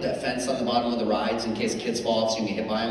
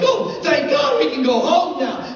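huge relief; 12 semitones higher, 7 dB louder, slower than usual. Acted. Relief when the ride ends.

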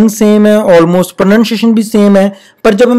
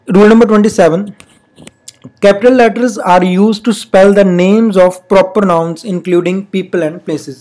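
very slow, spelled-out reading of the rule; slow classroom dictation; much slower than usual. Shown as a demonstration. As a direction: read it slowly so students can copy it. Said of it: "reading the rule slowly".

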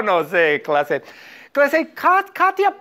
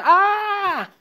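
very high, held "aah"; a hammed-up high tantrum yell; mock child's scream. Teacher asks student if she used to scream, high mock yell. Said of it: "¿Tú gritabas".

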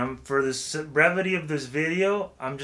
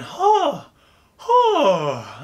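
sharp high falsetto "huh", louder; head voice huh; 10 semitones higher, 6 dB louder than usual. Shown as a demonstration. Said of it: "say the H, H sound".

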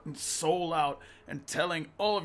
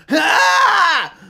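high shrieking charge yell; battle cry; 18 dB louder than usual. Retold or imitated. Hero charging at the villain with a high screaming cry. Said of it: "comes running with this".

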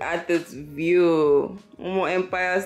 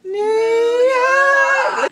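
New York called out high and loud; excited shout; 6 dB louder than usual. Real, not acted. Telling her viewers where they are, an excited shout. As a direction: belt the city name like an announcer.

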